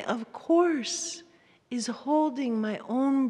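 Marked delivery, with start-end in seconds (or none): 0.0-1.2 "of course" lifted high and stretched on "course"; emphasis on course. Shown as a demonstration. Showing the audience her self-soothing phrase, stressed. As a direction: lean into "course". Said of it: "do you hear how my".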